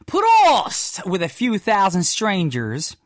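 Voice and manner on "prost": high, loud "Prost" yelled like a toast; mock yell. Host imitating festival crowds toasting with a loud yell. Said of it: "the idea of yelling".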